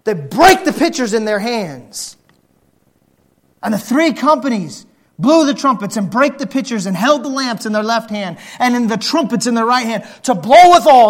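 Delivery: ordinary talk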